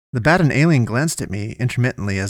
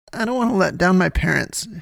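high, tearful acted whimper; lighter sobbing; 8 semitones higher than usual. A narrator's reading. Poe repeats herself after Damien says what, sobbing lightly. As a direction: repeat it as a lighter, higher sob. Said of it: "she sobbed even more lightly".

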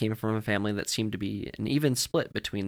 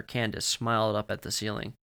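plain reading voice

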